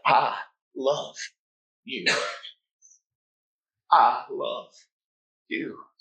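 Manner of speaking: whisper-close, a gentle declaration of love; hushed tender devotion; tender Jesus voice. Acted. Voicing Jesus's answer, hushed.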